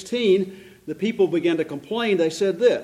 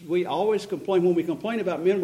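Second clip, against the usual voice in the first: ordinary delivery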